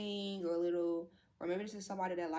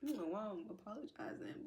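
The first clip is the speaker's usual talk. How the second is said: very soft, trying to hold it together; overcome, voice dropped right down; emotional apology; 3 semitones higher, 9 dB quieter than usual. Real, not acted. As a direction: apologise quietly through rising tears. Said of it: "let me get myself together".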